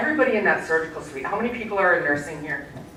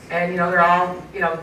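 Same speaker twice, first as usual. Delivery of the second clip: ordinary talk, nothing marked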